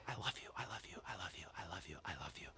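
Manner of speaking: tender whisper, barely audible; whispering in ear. Retold or imitated. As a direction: whisper it close into your little daughter's ear. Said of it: "I'll lean into her ear".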